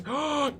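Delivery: a sharp, high gasp of shock. Real, not acted. Gamer sees Denmark join the enemy, shocked gasp. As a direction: gasp as if betrayed. Denmark turns on him in game.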